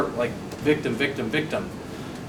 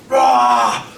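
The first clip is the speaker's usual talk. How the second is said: sharp, much higher, louder yell of frustration; frustrated yell; 9 semitones higher, 6 dB louder than usual. Retold or imitated. Salesman recalling hurling his binder in frustration, loud yell. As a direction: let out a wild frustrated shout. Throwing binder across street, yelling.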